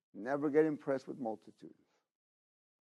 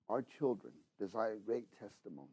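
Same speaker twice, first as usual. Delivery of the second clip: usual speaking voice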